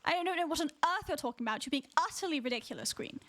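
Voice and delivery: huffy posh British denial; posh British accent. Pretend. Streamer denying being breathless to friend, huffy posh British. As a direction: dismiss it in proper British English. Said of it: "posh British accent".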